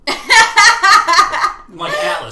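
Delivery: slow, high, loud laughing line; laughing Atlas. Real, not acted. Host laughs loudly while the bowl sits on his head. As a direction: strain and laugh it out.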